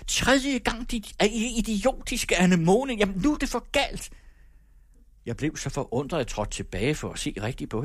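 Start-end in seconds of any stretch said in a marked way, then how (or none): 0.1-4.1 high, shrill-edged teacher's yell at the pupil; furious shout. A narrator's reading. Teacher loses her temper at the girl, shouting high. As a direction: scream the insult in rage. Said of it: "og råbte".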